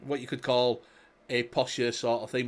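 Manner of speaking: normal talking voice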